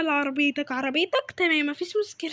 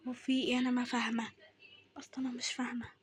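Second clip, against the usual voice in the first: low, very soft aside; baffled, under your breath; puzzled muttering; 5 semitones lower, 10 dB quieter than usual. Acted. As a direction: fade into a whisper of confusion. Puzzled, talking to herself.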